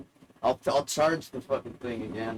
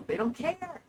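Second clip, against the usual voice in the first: high, lighter echo of the point; high-pitched repeat; 12 semitones higher, 3 dB quieter than usual. Real, not acted. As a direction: jump way up in pitch. Repeats the line much higher.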